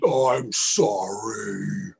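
very low, dragged-out bear apology; sorry bear. A narrator's reading. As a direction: drop way down and stretch it slowly. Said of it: "he was sorry".

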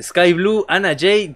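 ordinary talk, nothing marked

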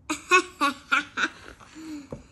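high, loud giggle; princess laughing. Acted. Princess laughing at the muddy king from her window. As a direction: burst into giggles. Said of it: "she started to laugh".

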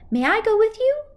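high, loud little cat. Acted. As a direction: pipe it up like a kitten. Animal asks to join.

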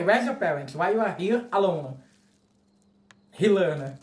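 ordinary delivery

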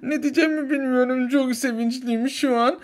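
high tearful laugh; overwhelmed joyful laughing cry; happy sobbing laugh; laughing through tears. Acted. Mother meets newborn.

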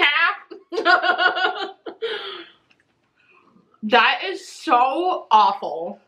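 laughing while chewing; talking while chewing. Real, not acted. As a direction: mouth partly full of candy. Seen 1: Right woman bites candy at -1.0, then chews, holding it, laughing.

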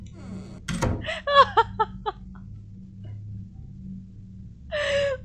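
crying laugh. Real, not acted.

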